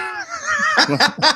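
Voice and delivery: helpless high laugh at a joke; high cackling laugh. Real, not acted. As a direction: burst out in high-pitched laughter.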